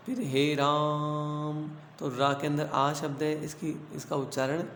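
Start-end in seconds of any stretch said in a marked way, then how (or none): 0.2-1.7 हे राम with the आ of रा held very long, three beats; drawn-out Ram. Shown as a demonstration. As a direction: sing out राम, stretching रा to triple length, very slow. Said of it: "जैसे तीन बार हमने रा बोला". (राम का आ तीन मात्रा तक खींचकर बोलें)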